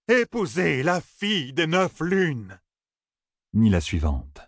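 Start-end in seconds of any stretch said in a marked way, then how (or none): 0.1-2.6 high, slow, drawn-out proclamation; cried prophecy. A narrator's reading. Risen oracle answering Matt, cried prophecy. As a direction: call out the fate slowly. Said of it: "lui cria cette réponse".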